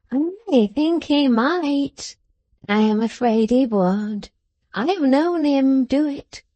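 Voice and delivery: put-on slow old man's voice, lilting upward; wary old grandfather. Old Smallweed answering his visitor about the bond, wary and slow. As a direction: draw it out slowly as a cautious old man.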